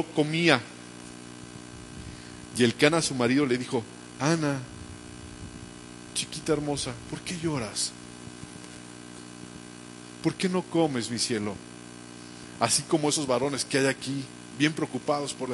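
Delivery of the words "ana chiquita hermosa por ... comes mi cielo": soft, loving plea to a weeping wife; tender husband. Acted. Husband gently asking his weeping wife why she cries, tender. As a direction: coax her gently, like a worried husband.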